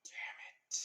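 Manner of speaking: plain reading voice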